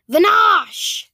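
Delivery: loud, high incantation; yelled spell. A narrator's reading. Boy banishes the captain, loud yelled spell. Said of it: "yelled Zarius".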